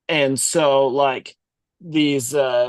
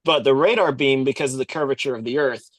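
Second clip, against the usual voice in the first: ordinary talk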